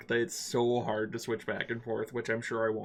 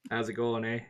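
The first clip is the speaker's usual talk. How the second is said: put-on Canadian accent. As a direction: go full Canadian, bud. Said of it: "do I do the Canadian accent?".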